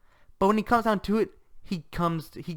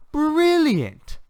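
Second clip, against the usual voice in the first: high, loud, drawn-out exclamation; emphatic praise; 7 dB louder than usual. Real, not acted. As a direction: crow it with total delight. Emphatic praise after a list.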